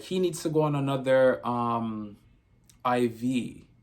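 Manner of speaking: a hint of Jamaican lilt, imitating the nurse; lightly imitated Jamaican lady; Jamaican accent. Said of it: "a Jamaican lady".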